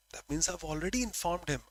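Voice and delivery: ordinary delivery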